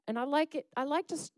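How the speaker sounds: usual speaking voice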